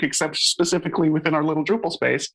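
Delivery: ordinary talk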